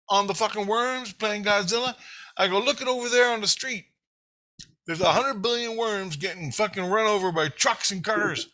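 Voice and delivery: high-pitched, indignant tirade at his heart; retold yelling rant. Kid arguing with his heart about worms, indignant retold rant. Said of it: "I'm yelling at him, just like I'm telling you".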